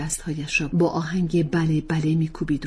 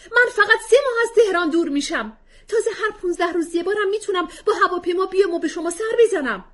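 much higher, louder, half laughing half crying; tearful protest; 9 dB louder, faster than usual. Acted. Wife protesting to husband, tearful and bitter. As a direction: argue through tears.

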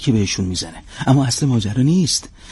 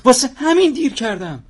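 higher, eager explanation; excited defense; 8 semitones higher than usual. Acted. Agent explaining to the captain why he's late, higher and eager. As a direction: pitch climbing as he justifies himself.

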